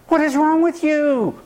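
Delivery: pitched high, exasperated demand; a mock outraged question to someone who won't get it; hammed-up disbelief. Exasperated quote of frustrated person.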